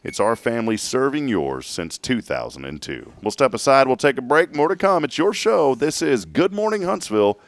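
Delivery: loud, upbeat sponsor read; radio announcer. Acted. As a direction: sell the sign-off.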